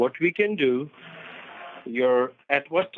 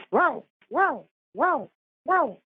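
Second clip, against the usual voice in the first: high-pitched imitation woofing; playful dog sound for a memory game; dog bark; 13 semitones higher, slower than usual. Shown as a demonstration. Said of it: "I'm going to sound one more time".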